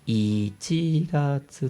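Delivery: "Ichigatsu" drawn out, up after the I and sagging only slightly; slow rising pitch demo. Shown as a demonstration. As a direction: stretch out "Ichigatsu" to show the pitch staying up. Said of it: "se va como cayendo un poquito".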